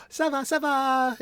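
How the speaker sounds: very high, slow, sing-song reply; imitating old Haitian lady. Old Haitian lady answering his greeting, imitated high and slow. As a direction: echo her answer up high and drawn out.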